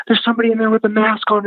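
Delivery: high, fast imitation of a frightened girl; retold panic. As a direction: blurt it out high and quick. Frightened girl reports the masked figure.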